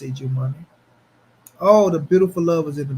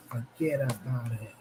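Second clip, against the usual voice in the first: mock mobster line; 5 semitones lower, 8 dB quieter than usual. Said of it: "What do the mobster mean when they be like".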